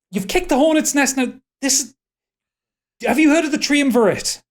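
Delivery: high, agitated character voice; alarmed warning. Acted. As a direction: warn them in a high, urgent voice. Alarmed character warns the players.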